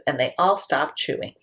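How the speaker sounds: ordinary delivery, nothing marked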